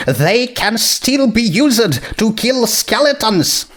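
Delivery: a princess warning the court's fillies and colts, earnestly; earnest princess's courtroom plea. Acted. Twilight's statement before sentencing.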